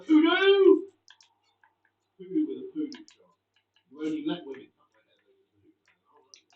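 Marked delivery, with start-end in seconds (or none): 0.0-0.9 loud high yell of joy; excited shout. Real, not acted. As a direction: roar the name out. Cheering a goal in game.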